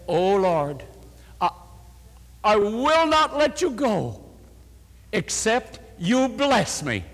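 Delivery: rising, slowed plea to God; pleading prayer. Retold or imitated. Retelling the dying woman's prayer, earnest high plea. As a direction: beg God not to let go, drawn out. Retold desperate prayer for healing.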